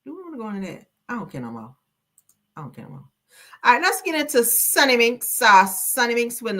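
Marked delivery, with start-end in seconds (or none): 2.6-3.1 much softer, trailing-off mutter; muttered repeat. Real, not acted. Shrugging the topic off to herself, much softer. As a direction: say it under your breath.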